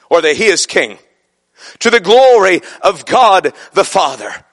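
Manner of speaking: ordinary talk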